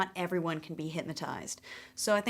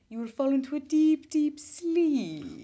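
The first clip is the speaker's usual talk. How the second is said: slow, loud, raised-pitch hypnotist's incantation; mock hypnotist; 4 semitones higher, 4 dB louder, slower than usual. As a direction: draw out each command like a stage hypnotist. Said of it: "Trying to hypnotize Grant".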